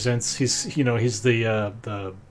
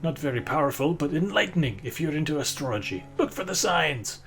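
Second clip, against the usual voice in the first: higher, unhurried sage voice; old wizard merchant; 3 semitones higher, 4 dB quieter than usual. Acted. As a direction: merchant wizard reading the tome to the boy in a lightly raised, knowing voice. Gizcog explains the tome.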